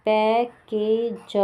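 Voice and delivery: Package drawn out very slowly; teacher slowly sounding out package. Shown as a demonstration. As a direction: stretch package so each vowel is clear. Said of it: "पी के बाद आ रही है".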